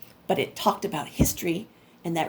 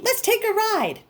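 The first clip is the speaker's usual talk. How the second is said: eager little brother; 11 semitones higher than usual. Acted. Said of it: "my brother Bebe said".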